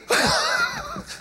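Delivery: a high, giggling burst of laughter; high fit of giggles. Real, not acted. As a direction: break into a squeaky fit of giggles. Boys laughing at the swearing.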